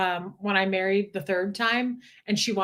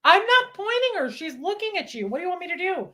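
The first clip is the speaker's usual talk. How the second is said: defensive protest; 8 semitones higher than usual. Real, not acted. Defending herself over the dog.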